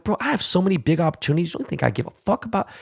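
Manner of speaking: mock-offended rebuttal to a friend; indignant retort. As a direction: scoff at the idea, voice jumping high.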